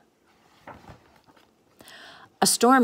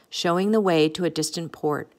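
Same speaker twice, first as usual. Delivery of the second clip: plain reading voice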